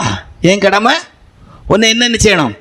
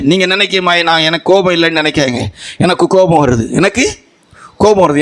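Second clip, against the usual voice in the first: ordinary delivery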